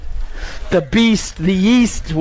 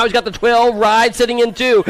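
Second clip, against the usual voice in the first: ordinary talk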